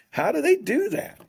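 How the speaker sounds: marvelling, pitch leaping high on they; wondering aloud how they manage it; amazed. Retold or imitated. Watching the great shooters, amazed at their breaks.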